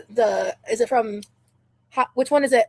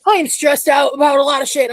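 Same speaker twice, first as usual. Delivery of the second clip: stressed outburst; 4 semitones higher, 8 dB louder than usual. Real, not acted. Venting to chat.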